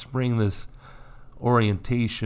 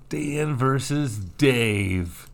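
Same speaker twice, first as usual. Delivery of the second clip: slow, amused, stretching out "versus"; laughing; 4 semitones higher, much slower than usual. Real, not acted. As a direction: savor the correction through a laugh. Laughing correction of names.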